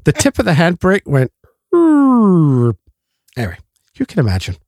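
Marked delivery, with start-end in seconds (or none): 1.7-2.7 drooping noise. Retold or imitated. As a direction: growl out a sagging noise. Handbrake tip noise imitated.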